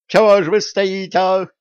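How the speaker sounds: high, loud panicked squeal; scared merchant screaming; terrified shriek. Acted. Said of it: "заверещал насмерть перепуганный купец".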